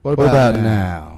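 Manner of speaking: slow, loud and deliberate into mic. Real, not acted. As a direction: project each word slowly and loud. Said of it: "Try again".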